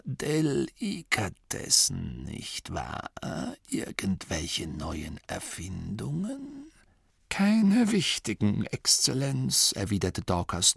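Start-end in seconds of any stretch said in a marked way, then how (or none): 0.0-6.7 put-on slow, faint old man's voice; frail old abbot, much softer and slower; ancient abbot. 7.3-9.7 higher, slightly slow polite reply; Dorcas voice. Acted. Dorcas answers abbot.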